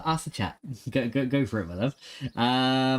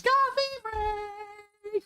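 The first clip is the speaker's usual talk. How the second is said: very high squeaky cartoon call; squealed catchphrase; mock announcer shout; much slower than usual. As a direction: shriek it like an excited cartoon hero. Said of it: "And Goku says".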